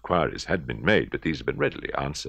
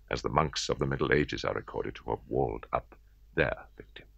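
whisper; 4 semitones lower, 5 dB quieter than usual. A narrator's reading. Narrator hushes a grim aside.

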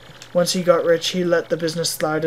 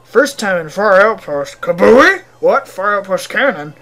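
put-on excitable Russian accent with loud outbursts; bad Russian accent; 3 semitones higher, 9 dB louder than usual. Villager covers up about the cannon, bad Russian accent. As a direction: blurt and backtrack in mock Russian. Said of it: "very shitty Russian accent".

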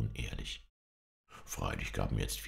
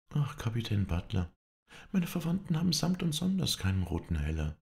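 higher, bright protesting lady's voice; Melanie; 9 semitones higher than usual. Acted. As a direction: lift it, modestly protesting. Melanie antwortet Red. (höhere, verlegen protestierende Frauenstimme)

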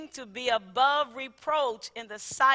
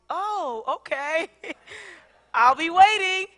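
with a smile; 4 semitones higher, 8 dB louder, slower than usual. Retold or imitated. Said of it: "With a smile".

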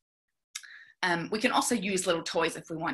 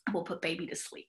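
ordinary talk, nothing marked

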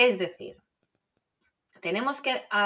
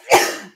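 sudden real sneeze; loud sneeze mid-sentence; 13 semitones higher, 13 dB louder than usual. Real, not acted. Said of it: "creo que voy a estornudar".

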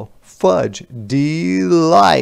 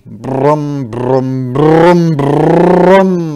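engine revving; 7 dB louder, much slower than usual. Acted. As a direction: rev up like an old car. Car engine starting again.

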